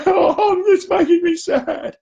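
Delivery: very high, loud, drawn-out mock wail; hammed-up slow crying of a serial crier; bursting into tears. Tearful pupil near the front of the room, exaggerated mock sobbing. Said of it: "burst into tears and said".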